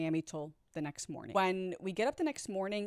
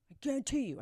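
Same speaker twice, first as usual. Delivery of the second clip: higher-pitched mock insistence; insistent mimicry; 7 semitones higher, faster than usual. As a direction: imitate him hammering the guarantee home. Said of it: "I still remember him saying that over and over".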